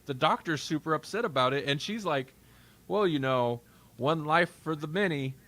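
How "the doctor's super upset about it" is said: ordinary talk, nothing marked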